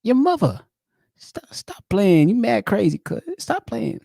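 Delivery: hammed-up New York cousin. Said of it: "New Yorkers talk crazy".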